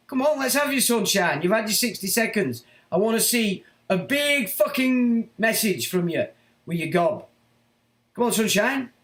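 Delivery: real anger at the critic; angry challenge. Real, not acted. Taunting the critic to type.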